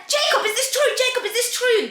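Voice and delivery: high-pitched, insistent interrogation; urgent questioning. Retold or imitated. Best friend confronting Jacob, urgently questioning. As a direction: imitate a scandalised demand for truth.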